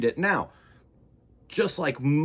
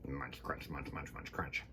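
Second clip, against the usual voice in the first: much softer, chewing rhythm; munching sound words; 10 semitones lower, 17 dB quieter than usual. Acted. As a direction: murmur it like a goat chewing away. Goat chewing, read aloud.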